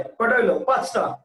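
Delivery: ordinary talk, nothing marked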